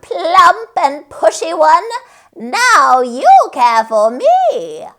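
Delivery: cackling creature voice. A narrator's reading. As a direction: gloat like a little witch. Sandwich creature commands.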